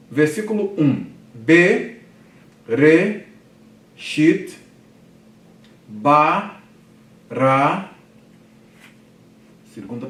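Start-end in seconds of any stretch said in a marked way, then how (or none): 1.3-7.9 loud, separated syllables with pauses between. Shown as a demonstration. Teacher reading first Hebrew verse to class, syllable by syllable. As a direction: sound it out for learners, one syllable at a time. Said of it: "a segmentação em sílabas".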